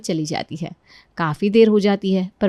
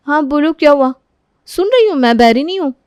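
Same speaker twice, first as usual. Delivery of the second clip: put-on high, louder snappy girl; irritated teen girl; 6 semitones higher, 6 dB louder than usual. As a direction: snap back impatiently. Sunita's catchphrase again. (भाभी पर झल्लाती ननद)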